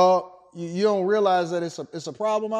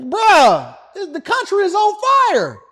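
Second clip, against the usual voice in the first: higher, louder yell; shouting; 11 semitones higher, 10 dB louder than usual. Acted. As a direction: yell in alarm. Shouting complaint.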